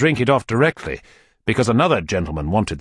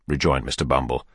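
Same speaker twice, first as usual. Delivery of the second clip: ordinary delivery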